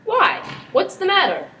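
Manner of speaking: sudden high startled question; startled alarm. Acted. Prince startled by his brother's warning, alarmed. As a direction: jump at the bad news.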